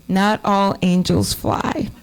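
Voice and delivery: put-on slow, gentle comfort to a child; tender reassurance. Mother comforting daughter.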